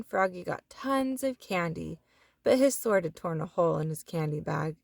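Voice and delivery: plain reading voice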